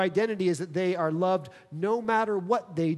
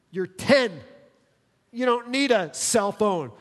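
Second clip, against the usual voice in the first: firm retort; 4 semitones higher, 5 dB louder than usual. Acted. Parent retort to child.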